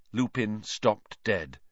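ordinary delivery, nothing marked

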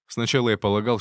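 ordinary delivery, nothing marked

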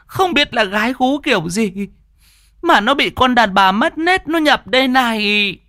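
high-pitched wailing plea for help; distraught wife, high and pleading; frantic wife. A narrator's reading. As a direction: cry out to the masters as a panicked village wife. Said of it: "vợ của ông nọ mới lên tiếng".

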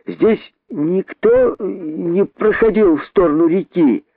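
high, slow drawn-out call; stretched-out holler, moderate volume. Acted. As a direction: call a question up into a tree. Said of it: "крикнул он снова мартышке".

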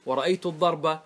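الضرب with ending -a, "ad-darba"; final fatha. Shown as a demonstration. Teacher listing case endings, الضرب as "ad-darba". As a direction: voice the last vowel of الضرب as a. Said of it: "لما تقف تنقل الحركات".

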